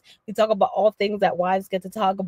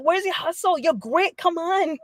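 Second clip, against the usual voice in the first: high-pitched, insistent egging-on; Dominican hustler coming out; 7 semitones higher than usual. Retold or imitated. Speaker urging the kid to show grit, hustler challenge. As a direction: push them with a lightly acted challenge. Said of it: "the Dominican and, and, and Laurentian and me comes out".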